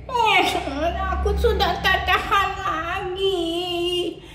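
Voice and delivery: put-on a whiny little boy who keeps complaining; sulky puppet boy. Puppet Adit exasperated.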